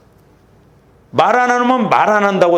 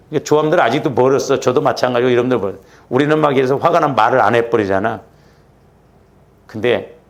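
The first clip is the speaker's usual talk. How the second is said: ordinary talk, nothing marked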